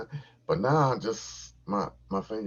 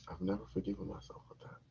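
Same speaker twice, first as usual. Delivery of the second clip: much softer, faster echo; muttered repeat; 12 dB quieter, faster than usual. Shown as a demonstration. Repeating his regret, soft quick mutter. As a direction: mutter it again to yourself.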